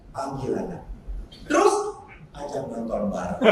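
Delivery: sangat rendah dan pelan; saran kalem. Retold or imitated. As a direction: jawab tenang, jauh di bawah suara biasa. Pastor memberi saran yang mengejutkan. (memberi saran dengan kalem dan pelan)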